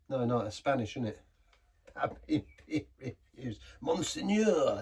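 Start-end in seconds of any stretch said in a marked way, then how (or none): 3.8-4.8 slow, drawn-out mock French Monseigneur; mock French word. Reviewer fumbling for a French word, slow mock French. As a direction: savour Monseigneur like a hammed-up Frenchman. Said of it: "Is that it, Monseigneur?".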